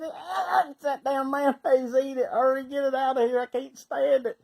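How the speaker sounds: high, strained, revolted yelling; frantic disgust. Retold or imitated. Answering his buddy about the mayonnaise, hammed-up panicked disgust. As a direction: beg frantically to escape a stink.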